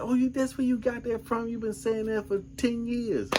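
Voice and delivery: high-pitched wife voice; imitated wife. Husband mimicking his wife finally getting his movie references, high-pitched. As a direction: mimic her realization way up high. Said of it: "She said".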